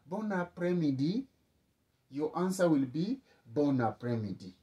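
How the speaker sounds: normal talking voice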